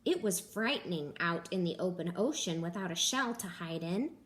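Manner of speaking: plain reading voice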